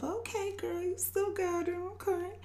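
high, soft inner voice; pleased self-talk. Retold or imitated. Woman in line thinking to herself she's still got it, high and soft self-praise. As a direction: hype yourself up quietly, pitched high. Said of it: "thinking to myself".

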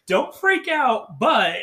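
high, drawn-out, hammed-up plea; mock strained reassurance. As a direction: stretch it out high as if holding back panic. Retells man announcing bad news.

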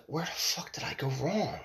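hushed, imagined self-questioning; asking oneself. Retold or imitated. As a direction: say it softly, as if to yourself. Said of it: "you say to yourself".

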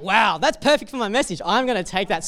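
delighted discovery, I'm taking that; excited. Retold or imitated. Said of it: "I was like".

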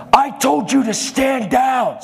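high, slow imitated shout; retold scream. Flight attendant screaming at preacher, mock furious shout. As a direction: shout the order, stretching every word. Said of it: "screaming at me".

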